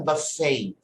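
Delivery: "Buffet" as buh-FAY, ending on a long A; American pronunciation. Shown as a demonstration. Teacher modelling the A at the end, American pronunciation. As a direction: stretch the final A of "Buffet", T silent. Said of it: "there will be an A at the end".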